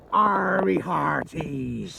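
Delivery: slow, drawn-out pirate talk. Pretend. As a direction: drawl it like an old sea dog. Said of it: "Can you do a pirate voice, [name]?".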